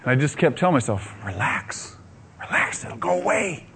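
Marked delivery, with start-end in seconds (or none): 1.2-3.7 hushed, trying to stay calm; whispery soothing to himself; quiet self-reassurance. Retold or imitated. Telling himself to relax, frightened.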